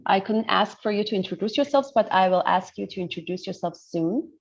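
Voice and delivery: ordinary delivery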